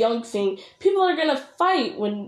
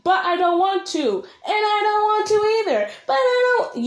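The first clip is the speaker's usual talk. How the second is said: high-pitched quarrel, climbing higher with each line; mock arguing yell; 10 semitones higher, 3 dB louder than usual. Shown as a demonstration. Creator mimics a squabbling couple, mock yelling. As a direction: act out a bickering yell, rising. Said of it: "you can't yell louder than louder".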